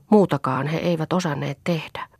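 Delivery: plain reading voice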